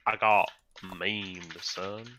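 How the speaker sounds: slow, drawn-out southern drawl; southern accent. Pretend. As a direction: stretch it like a southern boy. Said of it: "Fucking southern ass accent".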